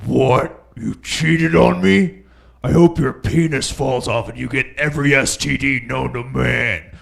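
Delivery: loud, mock-furious scorned girlfriend; furious woman. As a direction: rage at a cheating boyfriend, hammed up. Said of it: "They're like".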